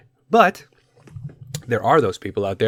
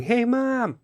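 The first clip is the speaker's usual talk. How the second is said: mock yell; 13 semitones higher than usual. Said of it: "instead of yelling".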